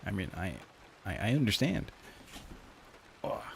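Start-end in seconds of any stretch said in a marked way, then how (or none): 3.2-3.4 short real groan of effort; stretching groan. Real, not acted. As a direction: grunt while stretching. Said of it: "I have to stretch".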